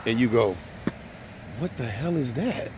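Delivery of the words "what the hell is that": softer, higher puzzled question, rising at the end; mock bewilderment at strange art; baffled reaction. Imagined reaction to gallery art.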